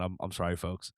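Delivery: usual speaking voice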